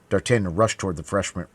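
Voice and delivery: plain reading voice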